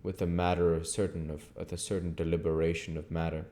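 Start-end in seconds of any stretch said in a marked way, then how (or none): none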